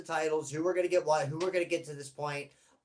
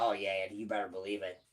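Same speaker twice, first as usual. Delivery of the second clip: second voice; 6 semitones lower, 5 dB quieter, faster than usual. Pretend. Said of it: "I do the two voices".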